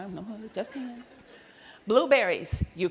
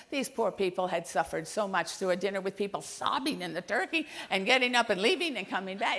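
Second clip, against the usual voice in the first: ordinary delivery, nothing marked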